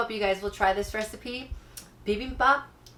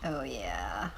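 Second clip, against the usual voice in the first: low savoring whisper; 11 semitones lower, 7 dB quieter than usual. Real, not acted. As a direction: whisper it with relish. Breaking the yolk, savoring.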